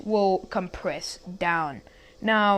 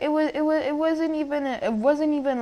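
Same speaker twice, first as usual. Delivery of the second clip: usual speaking voice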